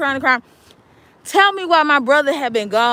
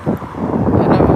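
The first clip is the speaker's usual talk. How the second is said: whispered hold on. Acted.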